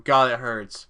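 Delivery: ordinary talk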